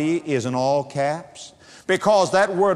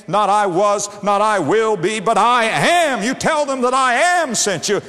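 rising higher and louder, slowing on I am; fervent preaching; 5 semitones higher, 3 dB louder than usual. Real, not acted. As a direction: proclaim God's name with growing force. Preacher's climactic declaration.

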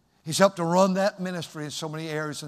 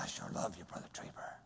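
very soft and low, almost fading to a whisper; hushed, low affection; tender hushed reply; 11 semitones lower, 19 dB quieter, faster than usual. Retold or imitated. Preacher answers his ailing friend, tender and hushed.

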